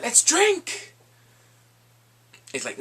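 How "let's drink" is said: high, loud hearty toast; hammed-up feast cheer; mock toast shout. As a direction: raise the glass and call it out.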